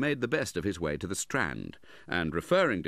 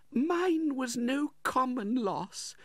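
grieving widow; slower than usual. A narrator's reading. Grieving widow voiced by narrator.

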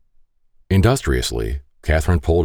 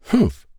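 high, scornful humph; grumbling humph. Acted. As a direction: huff at the machine. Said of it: "Catherine grumbled".

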